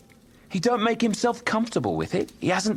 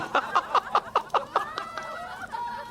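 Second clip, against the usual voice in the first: long, high amused laughing; cracking up at himself; high laughter; 5 dB quieter than usual. Acted. Nephew boasting he shook Scrooge, laughing.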